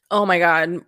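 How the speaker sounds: usual speaking voice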